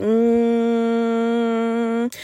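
long monotone hum; imitated humming. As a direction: hold one steady, drawn-out hum. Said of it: "like, humming".

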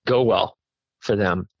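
ordinary talk, nothing marked

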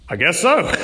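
amused, half-laughing reply; laughing through a reluctant yes. Retold or imitated. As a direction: chuckle through a bewildered answer. Father's stunned reply, laughing.

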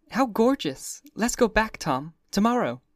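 put-on high, excited society girl; Daisy delighted. A narrator's reading. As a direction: gush with sudden delight. Daisy voiced, thrilled at news.

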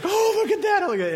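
giddy excited laugh-talk; fired up. Retold or imitated. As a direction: squeal it with delight at the bumps. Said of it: "I was all fired up about this".

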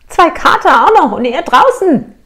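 high, loud mock astonishment; exclaimed disbelief. Client's situation, voiced in amazement. (hoch und laut gespielt fassungslos)

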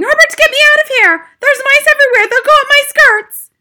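very high, very loud hysterical shriek; shrill screaming. A narrator's reading. Wife terrified of mice begging her husband for rescue, shrill screaming. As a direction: shriek in terror for your husband. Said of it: "It was screaming".

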